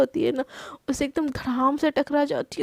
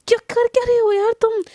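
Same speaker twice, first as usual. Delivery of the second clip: high, loud alarmed yelp; alarmed shout; 7 semitones higher, 6 dB louder than usual. Acted. Husband shrieks as wife lifts his shirt, high and loud.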